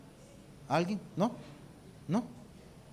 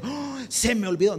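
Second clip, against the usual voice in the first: mock forgetful; 4 semitones higher than usual. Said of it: "no es que dijo".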